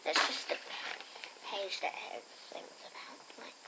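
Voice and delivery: soft unvoiced aside; whisper. Real, not acted. Reader whispering an aside to viewers, hushed. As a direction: breathe it under her breath. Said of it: "whispered aside".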